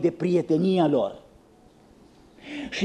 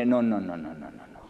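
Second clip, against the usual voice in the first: fading no; 13 semitones lower, 7 dB quieter, faster than usual. Retold or imitated. Jeremiah's fading refusal.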